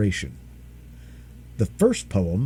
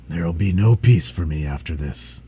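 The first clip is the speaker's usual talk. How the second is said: tired dragon; 7 semitones lower than usual. Acted. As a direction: sigh it out, exhausted. Dragon laments.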